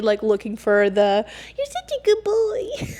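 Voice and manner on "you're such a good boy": put-on much higher baby-talk praise; praising a good boy. Praising her boyfriend like a pet, high baby talk. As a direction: coo it like praising a puppy. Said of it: "that little doe-eyed kid".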